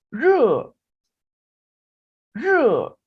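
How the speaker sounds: slow, loud Chinese model syllable; Chinese syllable demo. Shown as a demonstration. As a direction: say it clearly for repeating. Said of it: "इनको भी kindly मेरे साथ पढ़िएगा". (धीमे और ज़ोर से चीनी उच्चारण)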